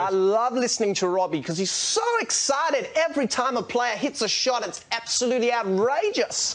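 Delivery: South African commentator gushing over every shot; overexcited South African tennis commentary; Robbie Koenig impression. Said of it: "Can you do the South African voice?".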